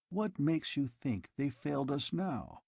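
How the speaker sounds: usual speaking voice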